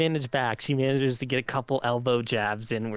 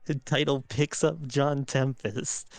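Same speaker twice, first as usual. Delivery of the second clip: usual speaking voice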